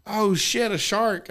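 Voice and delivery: a retold startled gasp of alarm; mock alarm. Telling friends how he spotted the fish, mock alarm. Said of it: "I was just like".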